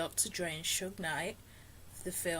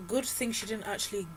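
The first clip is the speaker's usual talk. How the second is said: ordinary talk, nothing marked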